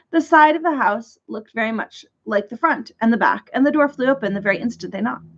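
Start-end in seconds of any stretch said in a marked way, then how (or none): none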